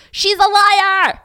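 loud, high, drawn-out yell; acted-out slow scream; screamed accusation. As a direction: scream the accusation like an enraged cop. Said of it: "and screamed".

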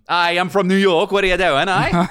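high, loud hammed-up Australian-tinged New York accent; Anthony LaPaglia. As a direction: overdo an Australian playing a New Yorker. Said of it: "I'm Anthony LaPaglia".

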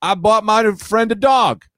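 high, drawn-out mock boast; gushing caller. Caller telling the show a good deed, high drawn-out gushing. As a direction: confess it in a silly high voice. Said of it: "people will say things like".

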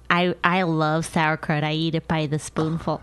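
mock a smug celebrity lightly; a hint of a smug TV guest; celebrity impression. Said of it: "when they're like".

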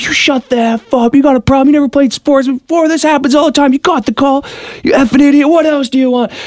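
hammed-up angry outburst at the opponent; losing it. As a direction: unload on the opponent. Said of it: "So I lose it".

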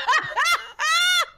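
high, loud shrieking laugh; hysterical laughter. Real, not acted. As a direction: let a hysterical cackle go. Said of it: "I laugh hysterically".

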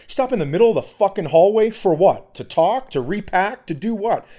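high, exasperated tirade; losing it. Retold or imitated. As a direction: fire indignant questions, starting loud. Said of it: "now I'm losing my shit".

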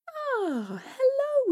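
high nervous greeting; mother. Acted. Mother answers the door to the hag, high and wary. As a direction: greet her warily. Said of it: "said Butterball's mother".

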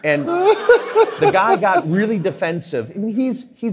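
slow, loud, high words broken by laughter; laughing while talking. Real, not acted. Laughing as he recalls the defensive banker. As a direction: laugh your way through the line.

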